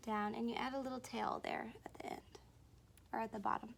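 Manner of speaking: fast soft whisper; quick hushed aside. Shown as a demonstration. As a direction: rush it out in a whisper.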